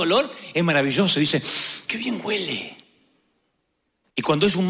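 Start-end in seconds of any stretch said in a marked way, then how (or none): 1.9-2.8 soft admiring aside. Pretend. Passerby admiring a nice smell.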